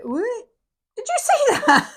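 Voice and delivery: high, loud surprised squeal; amazed the card jumped out. Real, not acted. Reader to viewers, high surprised exclamation.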